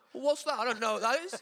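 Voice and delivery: imitating a heckler who shouts from the crowd; shouted heckle. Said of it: "someone will shout out".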